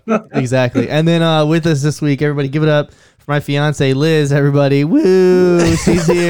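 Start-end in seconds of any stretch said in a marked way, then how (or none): 4.8-5.8 raised, loud "woo" with a voice crack; cheering woo, voice cracking. Real, not acted. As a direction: whoop that fails to reach the octave. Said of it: "your voice cracked and didn't go up the octave".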